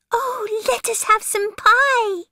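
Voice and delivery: very high pleading kitten voice. Acted. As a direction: beg sweetly like a kitten. Kittens beg for pie.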